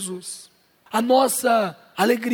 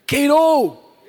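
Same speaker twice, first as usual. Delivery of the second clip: keirou shouted high and loud; loud call keirou; 4 semitones higher, 7 dB louder than usual. Shown as a demonstration. Pastor leading the congregation in shouting keirou loudly. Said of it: "Então fala de novo pra pessoa".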